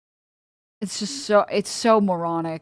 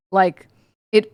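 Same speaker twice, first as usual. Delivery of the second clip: ordinary talk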